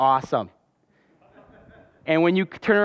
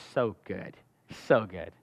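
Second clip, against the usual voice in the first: savoring; 9 semitones lower, 9 dB quieter than usual. Real, not acted. Savoring memory of a shake.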